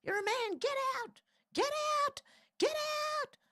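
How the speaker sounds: hammed-up angry Karen yelling get out; mock Karen scream. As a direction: shriek at a man in the women's toilets. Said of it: "I'll scream and go".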